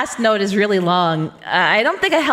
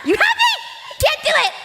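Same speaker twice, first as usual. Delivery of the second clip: exasperated squeal. Real, not acted. Streamer admitting defeat to her chat, high squeal. Giving up on tongue twister.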